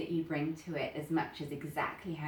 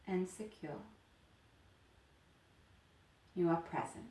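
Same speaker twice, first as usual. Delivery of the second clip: guided meditation. Acted. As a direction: lead them gently into stillness. Leads guided chakra meditation.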